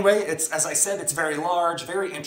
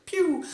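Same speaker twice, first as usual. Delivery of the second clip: high-pitched falling "pew"; playful pew sound effect; 13 semitones higher than usual. Pretend. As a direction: make a zippy laser-like pew. Spoken sound effect for moving on.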